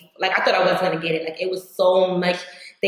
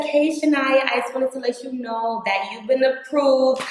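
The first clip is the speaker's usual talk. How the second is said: leasing agent on phone; 6 semitones higher than usual. Retold or imitated. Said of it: "And she's like".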